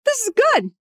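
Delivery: happy, high squeal of relief; giddy contentment. Retold or imitated. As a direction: squeal it like you've found bliss. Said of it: "I'm like".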